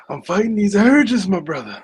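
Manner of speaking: mock wrestling promo line; imitating Bray Wyatt. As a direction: ham up a wrestler's eerie promo. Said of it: "The only thing that he, uh, got right was".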